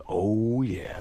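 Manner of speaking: sexy, drawn-out R&B croon; Brian Williams. Retold or imitated. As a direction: stretch it out like a slow jam. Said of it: "R and B type of sexy thing".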